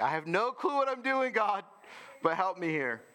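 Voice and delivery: high, frantic plea to God; mock desperate prayer. Praying to God when lost, high frantic plea. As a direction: cry out clueless to God. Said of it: "I'm like".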